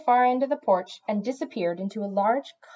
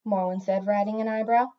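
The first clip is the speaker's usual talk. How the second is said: ordinary delivery, nothing marked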